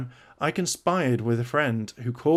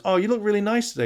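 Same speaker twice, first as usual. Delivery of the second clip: mock husband compliment; 8 semitones higher, faster than usual. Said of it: "James goes".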